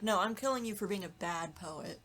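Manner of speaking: ordinary delivery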